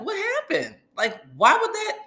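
high, shocked "what happened"; shocked disbelief. Retold or imitated. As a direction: gasp it out in disbelief. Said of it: "we're like".

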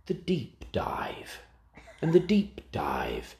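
slow hushed whisper, mock announcer; dramatic whisper. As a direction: breathe the segment title like a movie trailer. Announcing show segment.

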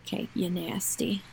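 teasing whisper. Real, not acted. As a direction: whisper it as a playful jab. Teasing the friend for chewing.

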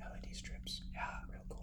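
hushed whisper; whisper yelling. Real, not acted. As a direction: whisper it under your breath. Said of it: "Why are we whispering?".

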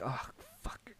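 whispered curse under the breath; dismayed whisper. Retold or imitated. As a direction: whisper it in dismay at what you're watching. His dismayed reaction, retold.

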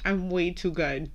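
ordinary delivery

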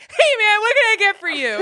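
high, loud overeager greeting; eager bartender. Retold or imitated. Bartender imitated in a TikTok, loud eager greeting. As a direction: greet the customer with sudden, over-the-top cheer.

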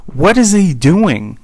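high, drawn-out mock indignation; theatrical. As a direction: imitate a cashier making a theatrical fuss. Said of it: "like, so theatrical".

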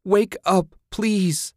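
high, loud sobbing plea; crying plea. A narrator's reading. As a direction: beg through tears. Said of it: "she cried".

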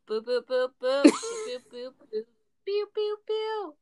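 spoken boo boo rising to high pew pew; mocking the jingle. Cohost mimics the drunk host's lazy transition, mock effect. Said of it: "you didn't sing it".

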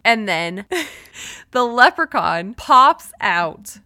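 ordinary talk

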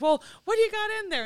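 high, quick, manic imitation; excitable, high-pitched quote. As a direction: fire it off like a manic rock star. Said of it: "He is just as manic in person".

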